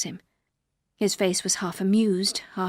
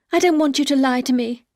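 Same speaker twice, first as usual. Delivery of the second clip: urgent plea; 4 semitones higher, 6 dB louder than usual. A narrator's reading. Wife begs husband for honesty.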